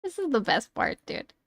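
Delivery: ordinary talk, nothing marked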